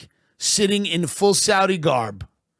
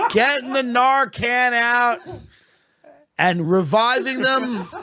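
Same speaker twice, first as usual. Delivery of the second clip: laughing; 8 semitones higher than usual. Real, not acted. Laughing through a dark joke.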